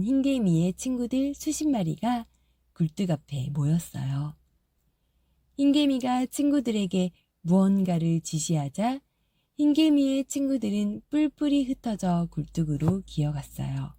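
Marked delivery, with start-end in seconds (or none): none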